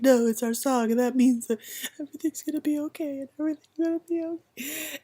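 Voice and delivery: high, fast, choked reassurance; tearful joy. Retold or imitated. Mother tells worried nurses it's their song, tearful. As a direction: insist through happy tears. Explaining happy tears to nurses.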